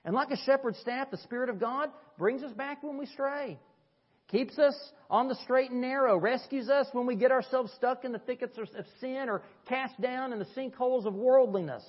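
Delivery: high, loud, ringing pulpit voice; swelling preacher's cry; preaching at full force. Acted. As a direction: lift the congregation with it. Preaching builds to a peak.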